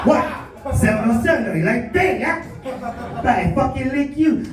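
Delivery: rowdy local in Hawaiian Pidgin; Hawaiian Pidgin accent. Pretend. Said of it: "Bro, I speak Pidgin too".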